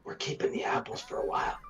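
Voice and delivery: unvoiced whisper. Real, not acted. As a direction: breathe it out as a secret. Whispered aside to party.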